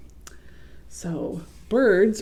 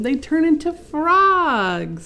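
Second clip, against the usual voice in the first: correcting dad; 9 semitones higher, 7 dB louder, slower than usual. Acted.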